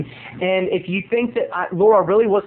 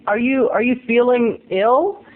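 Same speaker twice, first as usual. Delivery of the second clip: ordinary talk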